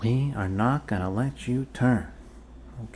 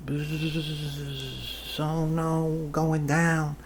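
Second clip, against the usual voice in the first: playful buzzing plane that crashes; airplane noise game; 3 semitones higher, much slower than usual. Pretend. To a patient, pretending the needle is a plane, playful buzz. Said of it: "pretend this was an airplane".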